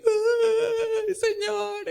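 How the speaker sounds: real tearful cry, voice lifted high; sobbing "¡Ay, Señor!". Real, not acted. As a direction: break into tears calling to the Lord. Overcome while watching the bird.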